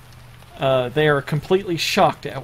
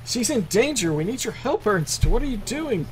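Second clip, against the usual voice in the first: high, swooping servant's voice; alarmed servant; 7 semitones higher than usual. Acted. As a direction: insist in high-pitched alarm. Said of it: "I'll speak for the servants".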